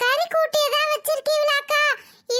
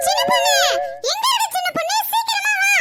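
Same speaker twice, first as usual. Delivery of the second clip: urgent shout; 6 semitones higher than usual. Acted. Neighbour shouting for the younger daughter to come. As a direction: yell for her to come quickly.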